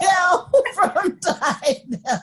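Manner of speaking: slow laughing words; drawn out through laughter. Real, not acted. Laughing at the name.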